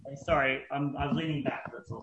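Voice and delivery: off mic, leaning back; turned away from mic. Real, not acted. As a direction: voice falling off the mic. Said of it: "you're off mic".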